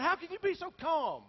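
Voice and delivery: high, loud incredulous question; exasperated. Retold or imitated. Pastor challenges the calm overseer, loud and high. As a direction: push back in disbelief.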